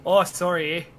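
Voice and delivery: Canadian accent with a rounded "sorry"; exaggerated Canadian "sorry". Shown as a demonstration. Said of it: "say it more Canadian".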